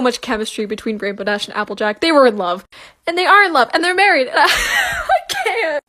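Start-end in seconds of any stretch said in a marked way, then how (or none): 2.0-4.3 loud, joy breaking into laughter; overjoyed and about to cry; excited shouting. Real, not acted. Fan joy building. 4.3-5.8 high, laughing through tears; overwhelmed happy sob-laugh; laughing crying. Real, not acted.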